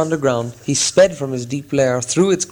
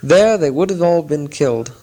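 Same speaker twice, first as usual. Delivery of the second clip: ordinary delivery, nothing marked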